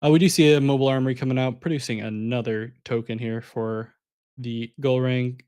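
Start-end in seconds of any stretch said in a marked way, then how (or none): 0.0-1.6 excited. Real, not acted. Caster calls a big play.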